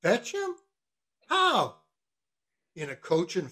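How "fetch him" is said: high-pitched questioning echo; incredulous echo. Acted. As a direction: throw it back as a question. Mate echoes the cook in disbelief.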